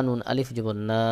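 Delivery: ordinary talk, nothing marked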